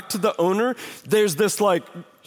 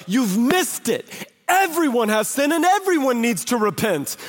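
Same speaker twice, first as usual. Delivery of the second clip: raised pitch, preacher voicing Jesus's correction; impassioned rebuke; 5 semitones higher than usual. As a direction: drive it home emphatically, pitched high. Jesus rebuking the self-righteous.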